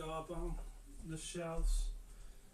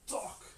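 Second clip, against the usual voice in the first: imitated thud; 9 semitones higher than usual. Imitates toe hitting table corner.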